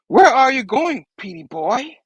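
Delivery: high mocking villain call; lightly voiced sneering villain; Goblin taunt. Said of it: "Goblin's like".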